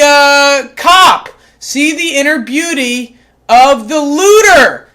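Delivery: from calm reading to furious yelling; calm reading building into an angry shout; building rant. Acted. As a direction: let the irritation boil over into a rant. Cat loses temper mid-reading.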